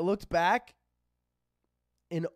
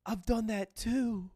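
soft, slow, higher-voiced sympathy; sweet, compassionate kid; 4 semitones higher, 5 dB quieter, much slower than usual. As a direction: commiserate gently, as an imitated kind child. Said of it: "just the sweetest, most compassionate kid".

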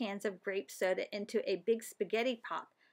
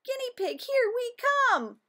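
an excited rallying call; excited cheer; 8 dB louder than usual. Acted. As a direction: sing it out high with glee. Class cheers heading to guinea pig.

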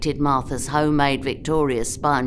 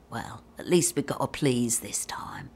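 low, soft, dry remark; quietly unimpressed, half-whispered; muttered aside; 6 semitones lower, 6 dB quieter than usual. Retold or imitated. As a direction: imitate a colleague grumbling under her breath. Colleague's dry aside after summons.

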